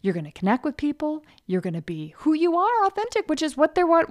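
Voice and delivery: ordinary talk, nothing marked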